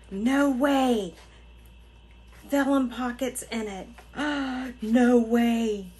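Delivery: gasping, loud, drawn-up disbelief; gasp, then exclaim in real amazement; thrilled shock at a surprise gift; astonished. Real, not acted.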